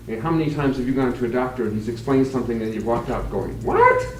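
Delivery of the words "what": a much higher, rising, bewildered "What?"; baffled what. Retold or imitated. Patient walking out of the doctor's office, baffled and rising. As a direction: squeak it out, totally lost.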